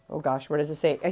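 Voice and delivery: normal talking voice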